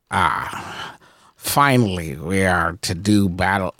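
low, slow, grandly sinister voice; Beacon. Acted. Sword speaking as it is drawn for battle, low and slow. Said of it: "I pull out beacon".

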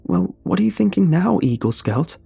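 high-pitched pointed question; pointed challenge. Acted. Frightened Sam challenges Josh near bear.

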